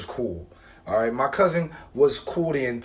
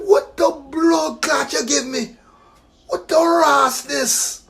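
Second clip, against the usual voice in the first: higher, slow patois outburst; drawn-out Jamaican indignation; Jamaican outrage; 10 semitones higher, much slower than usual. Retold or imitated. Outraged at picnic table.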